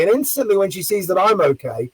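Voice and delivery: usual speaking voice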